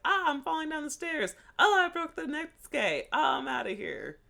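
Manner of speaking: high, hammed-up cry while falling down the stairs; mock falling lines. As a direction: squeal the lines like a cartoon fall. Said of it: "It's like".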